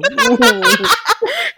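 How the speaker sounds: high, loud laughing outburst; burst of laughter. Real, not acted. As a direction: cracks up laughing. Laugh after seductive bit.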